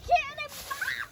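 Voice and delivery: high, loud, drawn-out yell; cannonball yell. Real, not acted. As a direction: shout it slowly as you leap. Yelled while jumping into grass.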